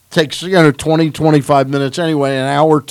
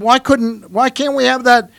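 higher, pleading complaint; whiny protest; 10 semitones higher than usual. Retold or imitated. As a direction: whine like a kid denied a treat. Billy Martin pleading for the table.

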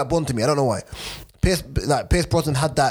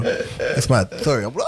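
ordinary talk, nothing marked

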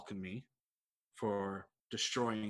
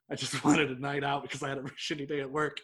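laughing through the words; 3 semitones higher, 5 dB louder than usual. Retold or imitated. Quoting own thoughts about a bad night.

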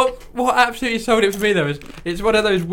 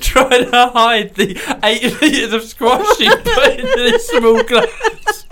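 slowing and rising into laughter; words climbing high as laughter takes over; laughing incredulously; 4 semitones higher, slower than usual. Real, not acted. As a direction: tease through mounting giggles. Teasing friend while cracking up.

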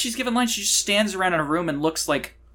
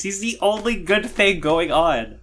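ordinary talk, nothing marked

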